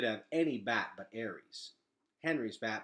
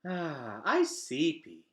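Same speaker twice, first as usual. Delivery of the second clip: lightly voiced sleepy toddler babble; a hint of a drowsy little child; 5 semitones higher, 7 dB louder, much slower than usual. Boots padding over to Gregor, lightly voiced sleepy toddler.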